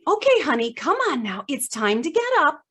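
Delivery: a lightly voiced coaxing therapist; elderspeak. Therapist urges a patient up in mild elderspeak. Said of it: "a professional's voice saying".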